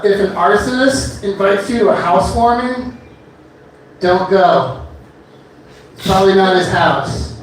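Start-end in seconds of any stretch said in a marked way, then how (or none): none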